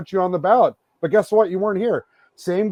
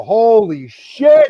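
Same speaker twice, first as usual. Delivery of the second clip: exasperated outburst; 6 dB louder, much slower than usual. Real, not acted. Ranting at fans on show.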